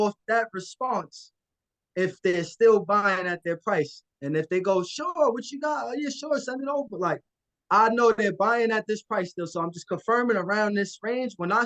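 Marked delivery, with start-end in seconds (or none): 4.8-7.0 a higher, keen buyer voice; eager buyer. Acted. Buyer on the phone replying to a wholesaler, eager and higher. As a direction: answer like a buyer eager for the deal.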